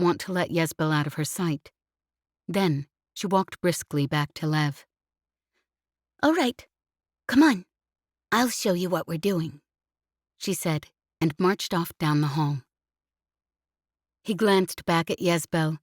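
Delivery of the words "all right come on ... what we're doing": put-on bossy little girl. A narrator's reading. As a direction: boss him along like an impatient little girl. Bossy child takes charge.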